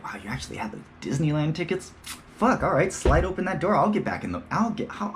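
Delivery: high, eager, gushing; excited kid voice. Pretend. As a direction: act thrilled like a gullible kid.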